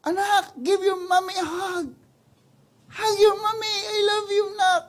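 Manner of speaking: high, loud, tearful mother's plea; mother pleading. Acted. Mother begging her child to come hug her, loud tearful plea. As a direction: beg the child back with a catch in the voice. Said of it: "Mga ganun na yung nanay".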